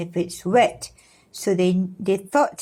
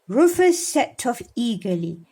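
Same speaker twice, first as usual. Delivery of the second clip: plain reading voice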